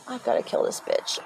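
breathy under-the-breath whisper. Real, not acted. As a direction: whisper it to yourself. About to kill the spider.

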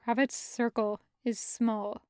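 usual speaking voice

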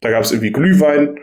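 usual speaking voice

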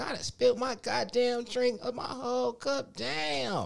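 high, slow, drawn-out mock wail; mimicked drunk wail. Imitating drunk woman who spilled her drink, high wail. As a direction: whine it out high like a sloppy drunk.

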